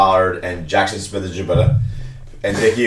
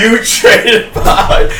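shocked; 12 semitones higher, 5 dB louder, slower than usual. Retold or imitated. Retelling a shocked outburst.

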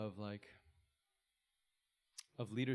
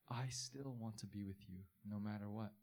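softer, lower voice of steady grace; gentle reassurance; 3 semitones lower, 8 dB quieter than usual. Acted. God's grace to the straying believer, soft and reassuring. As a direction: speak it as a promise, quietly.

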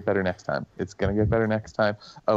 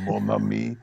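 put-on Creole accent. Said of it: "Creole accent saying".

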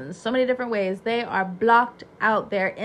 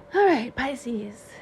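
whisper; much slower than usual. Real, not acted. Unvoiced hush.

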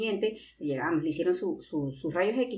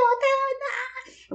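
very high, slow wailing bota, bota; mock wail; much slower than usual. Older sister mimicking her sister crying over the boot. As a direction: stretch it out in a high drunken wail.

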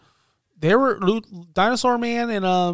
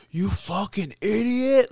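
a hammed-up angry yell, higher and slow; imitating the coach tearing into him; mock yell; 5 semitones higher, slower than usual. Brody yelling at John Silver, mock angry. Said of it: "he's just screaming at him".

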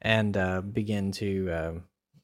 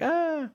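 high, thin mock scream; small high-pitched "ah" of mock fright; imitated fleeing cry; 3 dB quieter than usual. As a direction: imitate a scared creature running away. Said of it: "the T-Rex runs away".

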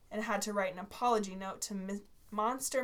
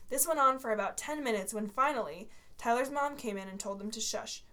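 plain reading voice

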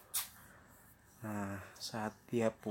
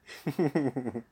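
rippling chuckle; real laughter; 2 semitones higher than usual. Real, not acted. Storyteller amused by the door joke, laughing. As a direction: laugh at your own joke.

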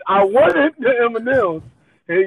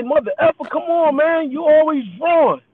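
angry customer; 8 semitones higher than usual. Retold or imitated. Angry customer yelling at cashier.

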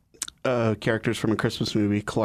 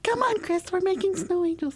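silly high voice. Acted. Quoting movie in silly voice.

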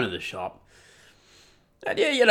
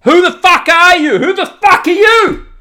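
high, loud furious yelling; going off; 12 semitones higher, 7 dB louder than usual. Retold or imitated. Drunk man going off, loud furious yell. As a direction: rant at everyone, loud and high. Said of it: "I just start going off".

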